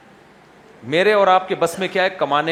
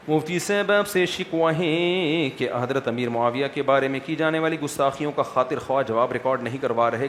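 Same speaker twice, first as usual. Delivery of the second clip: reading a question aloud; 5 semitones lower, 5 dB quieter than usual. Acted. Mufti reads a viewer's question to the camera, measured read-out. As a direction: read the question out evenly, a bit quieter.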